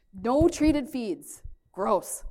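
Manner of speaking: emphatic disgust; loud firm warning. Real, not acted. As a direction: lay down the rule hard.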